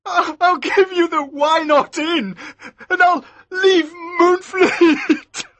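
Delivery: put-on crying like a baby. Maskew begging Elsevier, crying like a baby. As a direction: break down into sobs. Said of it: "started crying like a baby".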